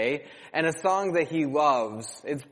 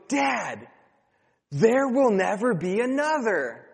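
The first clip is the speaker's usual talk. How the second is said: put-on excited child; 5 semitones higher than usual. Child's epiphany voiced.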